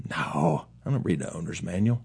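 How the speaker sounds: soft, dismissive customer voice; mumbled offhand reply; mock customer. Customer brushing off the mechanic, mumbled and soft.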